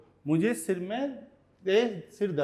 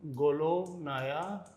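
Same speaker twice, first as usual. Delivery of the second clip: very slow, softer, stretched words; dictation pace; 5 dB quieter, much slower than usual. Shown as a demonstration. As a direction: dictate each word slowly while writing on screen. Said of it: "इस sentence को मैं यहाँ लिख देता हूँ".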